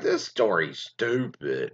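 slow, low bored scoff; mock their boredom; dismissive. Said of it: "people at the time were like".

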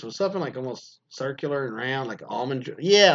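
ordinary talk

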